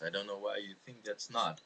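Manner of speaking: ordinary talk, nothing marked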